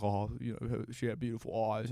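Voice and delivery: soft, hesitant mock boyfriend; lightly voiced guy carefully playing it safe. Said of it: "guy always usually plays it safe".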